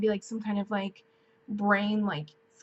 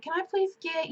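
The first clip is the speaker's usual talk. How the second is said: higher, playful ordering voice; being goofy at the Subway counter, pitched up; 7 semitones higher than usual. Retold or imitated. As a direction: order cheerfully, hamming it up. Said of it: "just being goofy".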